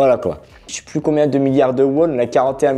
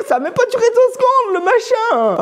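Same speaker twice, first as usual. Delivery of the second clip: high, laughing disbelief. Real, not acted. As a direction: laugh through the words. Laughs at quick failure.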